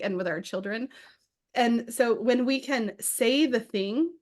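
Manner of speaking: ordinary talk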